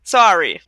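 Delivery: "Sorry" with an open "sah" vowel; copied American "sorry". Shown as a demonstration. Canadian checks with co-host, careful "Sorry" as SAH-ree. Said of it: "Is that it?".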